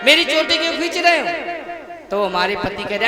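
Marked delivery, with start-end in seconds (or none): 0.1-1.3 raised pitch, scolding question; mock indignant wife. Gopi to her husband pulling her braid, mock indignant. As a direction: act out a put-out wife's protest.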